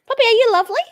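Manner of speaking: high, loud cooing question; pet-talk cooing. Real, not acted. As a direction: coo excitedly at a puppy. Cooing question to her dog.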